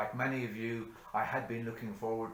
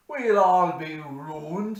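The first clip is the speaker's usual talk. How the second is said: forlorn lament; 7 semitones higher, 13 dB louder, much slower than usual. Acted. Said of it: "said Hanrahan".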